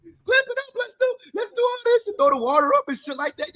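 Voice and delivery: mock overjoyed players squealing in disbelief. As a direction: shriek like you can't believe you got away with it. Same Falcons celebration continued.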